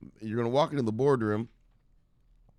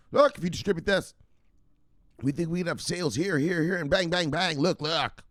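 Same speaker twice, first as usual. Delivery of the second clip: higher, keyed-up presenter voice; eager sales pitch; 7 semitones higher than usual. Acted.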